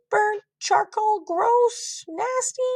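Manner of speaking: put-on high, bratty child voice; mocking little kid voice; snotty kid voice. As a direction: sneer it like a rude kid. Child's mean inner thought.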